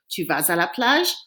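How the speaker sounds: "Tu vas à la plage?" going up at the end; rising question tune. Shown as a demonstration. Teacher repeats the example question for students, rising question tune on "plage". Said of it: "just going up at the end".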